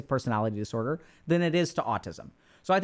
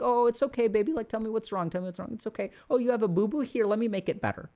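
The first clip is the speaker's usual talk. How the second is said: soothing parent; 4 semitones higher than usual. Acted. Parent comforting a crying child, acted tender soothing. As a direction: coo gently, as if to an upset little one. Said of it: "the parent's like".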